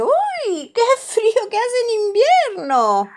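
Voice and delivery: very high, loud shivering squeal; Pepa shivering. A narrator's reading. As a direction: shriek it like a freezing little girl. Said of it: "Pepa mira las fotos y dice".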